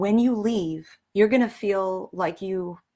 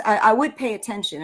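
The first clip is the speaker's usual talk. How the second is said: normal talking voice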